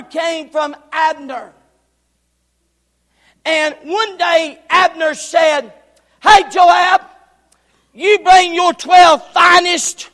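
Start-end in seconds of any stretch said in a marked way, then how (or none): 6.2-10.1 loud challenging call across the creek. Acted. Abner calls Joab across the creek, loud hail.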